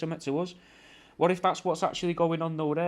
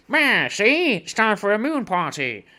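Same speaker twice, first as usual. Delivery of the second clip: much higher, louder mock American accent; hammed-up American accent, much higher and louder; 6 semitones higher, 7 dB louder, slower than usual. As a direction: ham up a loud American tourist. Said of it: "you know, speaking like that".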